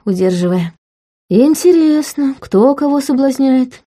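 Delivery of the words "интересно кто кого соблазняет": slow, higher, lightly sleepy-husky question; husky from sleep. A narrator's reading. Man waking to her touch, asking in a sleep-husky voice. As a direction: draw it out as if just woken. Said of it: "Его голос был хриплым со сна".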